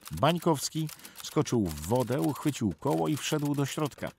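usual speaking voice

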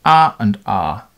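ah sounded as a slow open vowel, twice; two drawn-out examples of ah; voiced sound demo. Shown as a demonstration. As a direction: hold each ah as a bare voiced sound. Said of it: "So voiced sounds tend to cut poorly".

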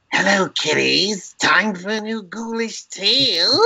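high, slow ghoulish host voice; Crypt Keeper impression. Showing friends his old Crypt Keeper bit in a high, slow ghoul voice. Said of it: "trying to emulate the, the guy who did the voice".

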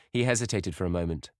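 plain reading voice

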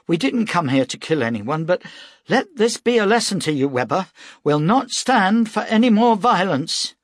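stern warning. A narrator's reading. Fenner warning Weber.

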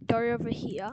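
slipping into a British accent; British vowels, dropped r in "here". Real, not acted. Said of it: "I talked in a British accent there".

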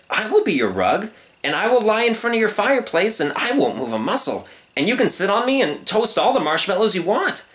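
high, innocent pleading; earnest, singsong cub; eager young lion. Acted. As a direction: offer yourself up helpfully. Young lion offers a deal.

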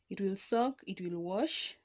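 normal talking voice

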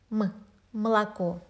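молоко with the first O nearly dropped, like "mlakó"; reduced first vowel. Shown as a demonstration. Teacher demonstrating vowel reduction in молоко, first O nearly silent. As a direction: swallow the first O of молоко, stress the last. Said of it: "it's almost not pronounced at all".

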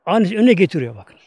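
ordinary talk

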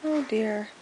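ordinary talk, nothing marked

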